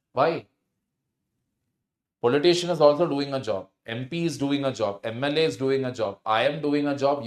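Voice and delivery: ordinary talk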